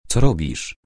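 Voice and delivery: ordinary delivery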